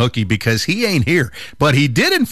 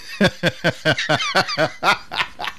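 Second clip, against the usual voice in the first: quiet laughter; 5 dB quieter than usual. Real, not acted. Laughing after dad joke.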